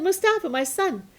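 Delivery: lightly voiced mother asking for salt; mother's voice.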